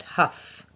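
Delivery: "huff" with a breathy, voiceless h; breathy h stress. Shown as a demonstration. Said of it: "Starts with a h sound".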